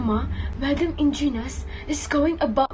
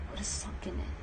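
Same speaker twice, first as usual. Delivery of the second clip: unvoiced whisper, much softer; whispered retort; 8 semitones lower, 15 dB quieter, faster than usual. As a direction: mutter it so the guard can't hear. Muttered retort at guard.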